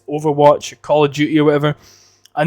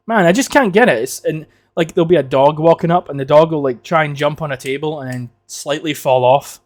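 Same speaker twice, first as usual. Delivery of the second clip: ordinary delivery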